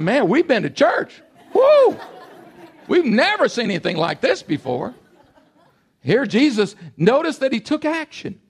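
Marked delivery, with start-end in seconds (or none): 0.0-4.9 excited, higher-pitched mock amazement; amazed churchgoers. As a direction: marvel like people buzzing after church. Acting amazed churchgoers leaving synagogue.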